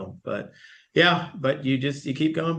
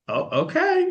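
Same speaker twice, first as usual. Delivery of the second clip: startled assistant; slower than usual. Retold or imitated.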